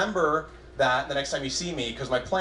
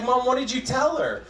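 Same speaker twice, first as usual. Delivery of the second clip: put-on exasperated kid; 9 semitones higher than usual. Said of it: "I was like".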